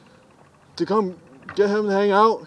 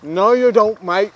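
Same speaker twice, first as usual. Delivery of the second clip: mock Australian; 2 semitones higher than usual. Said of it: "I'm not Australian".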